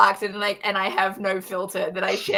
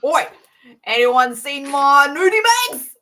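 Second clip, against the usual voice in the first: loud, higher, thick Aussie bloke; hardcore Aussie accent; 4 semitones higher, 7 dB louder than usual. Acted. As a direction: holler it in a thick Australian accent. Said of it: "Hardcore Aussie".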